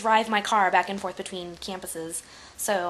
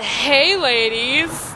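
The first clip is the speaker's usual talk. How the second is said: loud mock catcall; catcalling guy; 6 semitones higher, 9 dB louder, much slower than usual. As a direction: call out like a street flirt. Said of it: "they said".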